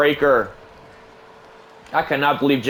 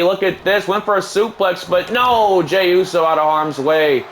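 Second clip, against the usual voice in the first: higher, quick surprised play-by-play; excited call; 5 semitones higher than usual. Acted. Commentator calling a suplex escape to viewers, excited. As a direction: sell the escape.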